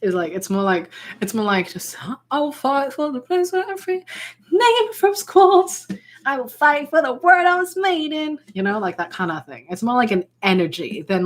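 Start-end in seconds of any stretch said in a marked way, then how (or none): 2.3-8.4 half-sung, higher and brighter Pearl; lightly voiced Pearl; Pearl impression. Shown as a demonstration. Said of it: "the impression is more like introducing".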